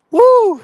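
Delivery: loud high whoop; excited whoop. Real, not acted. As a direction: whoop to hype the crowd. Hyping up his live stream.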